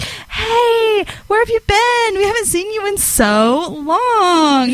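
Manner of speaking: high-pitched, mock-squealing friends' hello; gushing greeting. Two friends greeting her at the dance, gushing retold welcome. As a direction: imitate gushy friends, very high, drawing out the end.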